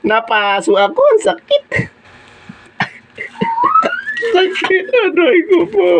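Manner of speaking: high, pained yelps after a burn; crying out in pain. Real, not acted. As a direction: yelp it out like you just got scalded. Just scalded by boiling water.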